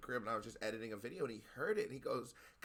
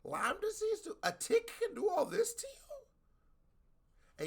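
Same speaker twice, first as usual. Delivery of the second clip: very high, incredulous questioning; real disbelief; 3 dB louder, slower than usual. Real, not acted. Listener learning a tick caused the illness, incredulous. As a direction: pitch shoots up in stunned disbelief, dragging out the question.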